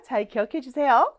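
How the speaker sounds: ordinary talk, nothing marked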